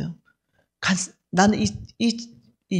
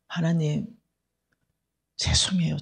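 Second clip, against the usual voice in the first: breathy unvoiced plea, falling away; whispered apology; 4 semitones lower, 5 dB quieter than usual. Praying alone in the car to God, whispered. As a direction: whisper sorry to God under your breath.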